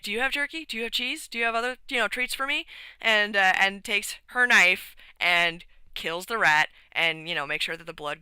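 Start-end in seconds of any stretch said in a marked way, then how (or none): 0.0-2.6 higher, hopeful little rat voice; lightly voiced eager rat; begging rat. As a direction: pester for treats. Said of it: "the rat's just like".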